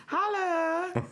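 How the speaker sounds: very high squealed holler; Wendy. Acted. Sister ending every conversation, very high holler. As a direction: call it out sky-high. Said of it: "End of every conversation".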